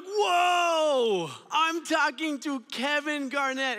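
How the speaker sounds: high, loud starstruck whoa; amazed shout. Retold or imitated. Kid hearing his hero on the phone, excited shout. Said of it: "I'm like".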